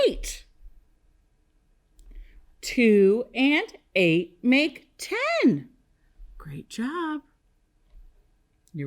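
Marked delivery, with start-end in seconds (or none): 2.6-5.7 slow, word-by-word recital; leading choral repetition. Shown as a demonstration. Teacher leading kindergartners to say it with her, slow choral pace. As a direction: space out each word so all can join. Leads class in saying number sentence.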